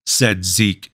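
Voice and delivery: usual speaking voice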